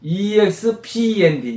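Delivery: 이엑스피엔디 spelled out slowly, letter by letter; slow spelling. Shown as a demonstration. Teacher spelling a word slowly for moms. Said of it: "spelling e x p n d".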